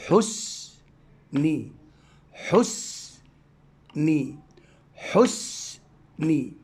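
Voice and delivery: حسني drawn out very slowly, the sukun on s held; slow syllable reading. Shown as a demonstration. Teacher modelling حسني slowly for young pupils. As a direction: stretch حسني very slowly for the class. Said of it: "فأقول".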